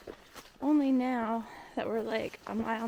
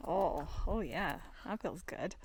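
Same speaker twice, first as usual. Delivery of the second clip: relieved sigh; 5 semitones lower, 8 dB quieter than usual. Real, not acted. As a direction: sink into it, relieved. Lying in cold snow.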